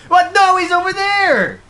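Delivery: scared yell. Real, not acted. Scared player yelling at game.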